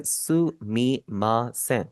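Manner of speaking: सुमीमासेन drawn out slowly, syllable by syllable; slow model phrase. Shown as a demonstration. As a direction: spell out सुमीमासेन slowly for learners. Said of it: "Japanese में जो phrase इस्तेमाल करते हैं". (सुमीमासेन धीरे-धीरे, साफ़ उच्चारण में)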